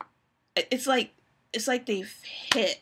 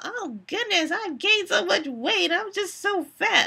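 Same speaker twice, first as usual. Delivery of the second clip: mock skinny friend; 9 semitones higher than usual. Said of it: "tiny friends talking about".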